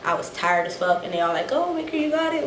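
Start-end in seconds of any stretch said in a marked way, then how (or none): 1.5-2.5 light sing-song mimic of the unit; lightly mocking the squad; mocking the others. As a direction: toss off their line in a higher, airy voice. Soldiers telling her to do it.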